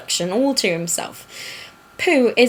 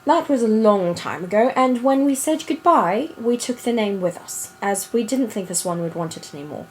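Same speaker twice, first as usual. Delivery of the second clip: put-on storybook reading in an affected British accent. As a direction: read it aloud like a British storyteller. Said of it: "do it in that accent".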